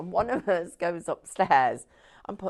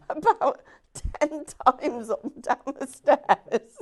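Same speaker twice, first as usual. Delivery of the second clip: slow, high, helpless giggly delivery; laughing while talking; 6 semitones higher, 5 dB louder, slower than usual. Real, not acted. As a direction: barely get it out through laughter. Tickled by colleague's repeated stair trips.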